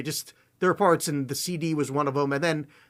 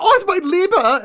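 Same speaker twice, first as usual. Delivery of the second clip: loud, high-pitched mock German outburst; over-the-top German exclamation; 12 semitones higher, 9 dB louder than usual. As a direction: cry out in a hammed-up German accent. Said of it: "that's why everybody goes".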